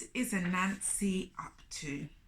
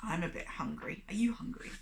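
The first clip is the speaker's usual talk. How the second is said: plain reading voice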